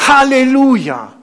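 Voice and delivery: high, jubilant loud cry; lautes Rufen. Acted. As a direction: call it out to the crowd. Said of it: "andere riefen". (jubelnd gerufen)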